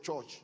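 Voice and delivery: "church" as said in Nigeria, with an o-like vowel; Nigerian-style "church"; Nigerian pronunciation. Shown as a demonstration. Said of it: "Nigeria, you know, where people worship, they call it".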